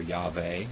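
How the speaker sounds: Yahweh said YAH-vay; variant pronunciation. Shown as a demonstration. Teacher listing possible pronunciations, Yahweh as YAH-vay. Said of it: "however it's supposed to pronounce".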